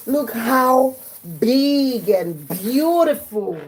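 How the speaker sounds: slow, drawn-out amazement; amazed gasp. Retold or imitated. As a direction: stretch every word in awe. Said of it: "he was now like".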